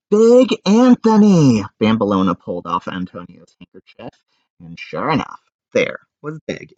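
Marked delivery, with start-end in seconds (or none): 0.1-1.6 high, loud, slow exclamation; slow high shocked cry. A narrator's reading.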